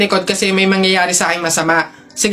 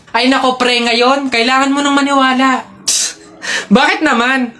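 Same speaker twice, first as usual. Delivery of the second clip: ordinary talk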